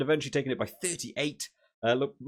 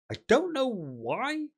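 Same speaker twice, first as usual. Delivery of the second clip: puzzled; 9 semitones higher, much slower than usual. Real, not acted. Puzzled by Ben's DNQ.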